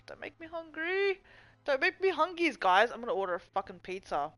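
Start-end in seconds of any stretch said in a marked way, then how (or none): 0.1-1.2 put-on high whiny baby voice; whiny plea. Whiny plea about hunger. 1.6-2.6 put-on high babyish whine on hungies; baby talk. Babytalk version of same line.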